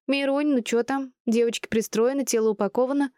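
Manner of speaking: put-on high, playful girlfriend voice; chirpy, amused questioning; merry teasing. A narrator's reading. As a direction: tease your friend, pitched well up. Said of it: "веселилась Белка".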